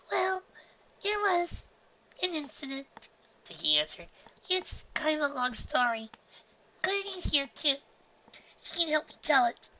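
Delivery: high, hesitant filly; shy little girl; nervous filly voice. A narrator's reading. Dinky's filly voice.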